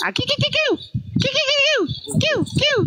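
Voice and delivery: very high squeaky cooing noises; squeaky baby coo. Pretend. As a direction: squeak like a cooing baby toy to tease him. Mocking cooing at crying man. (suara bayi melengking tinggi mengejek)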